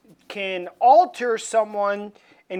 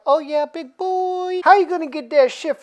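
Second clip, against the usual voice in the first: sultry villainess; 8 semitones higher than usual. Retold or imitated. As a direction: tease him into doing her work. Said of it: "She's like".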